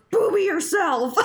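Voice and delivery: high-pitched comeback, half shouted; mock-indignant high retort; retort as Tom. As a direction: throw the insult straight back. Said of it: "then Tom goes".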